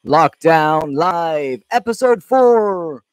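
big booming announcer intro, slow and drawn out; show announcer. Pretend. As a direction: announce yourself like a ring MC to a crowd.

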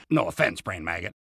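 hushed quick apology; quiet aside. Acted. Aside to the brain maggot.

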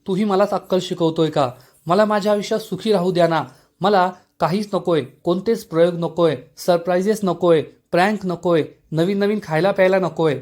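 plain reading voice

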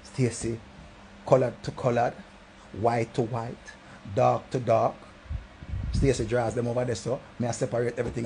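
ordinary delivery

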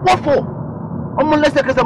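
high, loud disbelieving snap; what-for outburst at the greedy planners; indignant outrage. Acted. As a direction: demand to know why, outraged. Outraged at the extra money demand.

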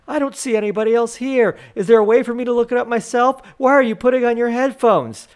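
high-pitched mock rant; ranting maniac. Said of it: "She began ranting like a maniac".

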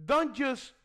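ordinary talk

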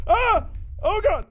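high yelp of fright; real shocked yelp; startled scream. Real, not acted. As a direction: cry out at a sudden scare. Jump scare in game.

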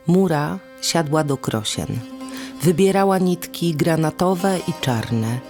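usual speaking voice

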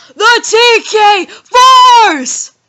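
huge drawn-out announcer shout; ring announcer voice. Shown as a demonstration. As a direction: introduce the champ into the ring. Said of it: "The old ring announcer voice".

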